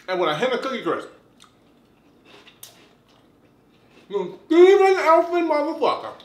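talking between bites; eating cereal while speaking; mouth full chewing. Real, not acted. Seen 0: Spoon enters mouth at 3.3; chews with bowl held afterward.

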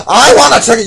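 loud demand at the counter; yelling. Acted. Customer yelling his ticket order at the clerk. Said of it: "You don't have to yell".

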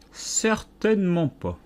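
high, slow disdainful reply; dismissive caterpillar. A narrator's reading. Caterpillar slowly and haughtily refuses Alice's idea. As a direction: drag out the refusal.

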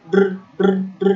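ড্র with a long rolled r; over-trilled r. Shown as a demonstration. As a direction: demonstrate the wrong, many-tap ড্র. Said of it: "দুইবার বাড়ি খায়".

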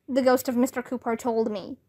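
ordinary delivery

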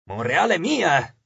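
plain reading voice

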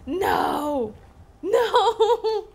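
loud high laughing no; laughing protest. Real, not acted. Retelling friend's bad advice.

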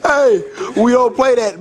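usual speaking voice

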